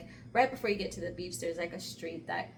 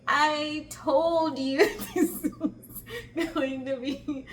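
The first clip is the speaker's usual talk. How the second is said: high, loud, slow mock scolding; laughing I-told-you-so; 8 semitones higher, 6 dB louder, much slower than usual. Telling the slipping friend off, laughing mock reproach. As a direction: scold him through laughter, stretching the words.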